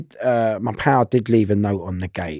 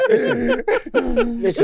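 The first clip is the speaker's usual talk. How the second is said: laughing. Real, not acted. Laughing hard.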